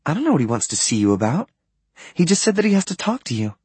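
put-on light girlish read of the line; lightly voiced young woman. A narrator's reading. Narrator voices Blair on phone.

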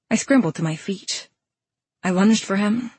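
plain reading voice

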